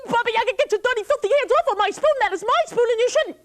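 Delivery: put-on high, indignant boy; Sasha. Sasha scolding Baba Yaga, high and indignant. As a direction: scold the witch.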